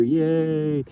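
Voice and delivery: a high, cartoonish little "yay"; mock cheer. Cheering the organic matter in his lawn, high mock yay. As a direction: cheer it like a tiny game-show crowd.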